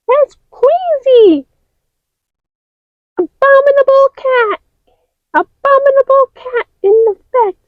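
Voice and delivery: high, panicked yelps; high frightened shrieks; scared character. Acted. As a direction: scream the monster away. Figure panics at monster.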